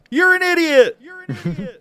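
loud high echoing shout. Retold or imitated. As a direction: yell it back like a mocking echo. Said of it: "the Echo instead just says".

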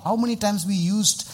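ordinary talk

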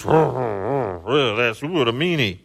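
slow, drawn-out imitated whining; mock hurt. Preacher imitates the guy he scolded, mock hurt. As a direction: mimic a wounded complainer, stretching it out.

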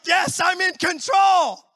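mock shout of control; smug triumphant mock. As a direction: crow it like you own the road. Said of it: "and we're like".

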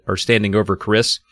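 plain reading voice

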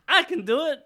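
very high, loud mock impression; Ike voice. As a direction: send your voice way up, mocking another guy.

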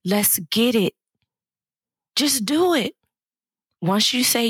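normal talking voice

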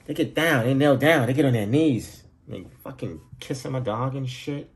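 ordinary talk, nothing marked

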